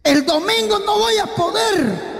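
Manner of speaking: crying out the excuse; a singer wailing he cannot come Sunday, mock crying. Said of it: "canta [name] para el día domingo".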